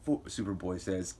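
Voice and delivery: plain reading voice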